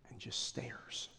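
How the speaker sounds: whispered, slow and breathless; hushed stare. Acted. Preacher to congregation, whispering the stare. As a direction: let the words drop to a hush. Preacher acts out Jesus staring.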